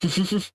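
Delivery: quick high giggle; short laugh. Real, not acted. Joking with chat about a friend, short giggle.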